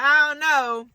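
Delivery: high, loud mock reluctance; comic sing-song misgiving; wary hesitation. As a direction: pitch it up, doubtful and careful. Repeats her wary reaction.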